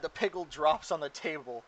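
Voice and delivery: usual speaking voice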